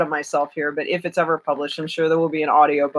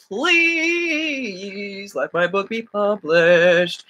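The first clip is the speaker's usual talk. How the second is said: long drawn-out, singsong plea; mock pleading; 3 semitones higher, much slower than usual. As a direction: beg dramatically, stretching out the please. Comic plea for being published.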